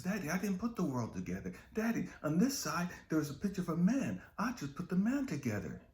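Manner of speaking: put-on high eager child's voice; a son insisting to his dad that he finished; proud little boy. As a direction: insist like a proud small kid.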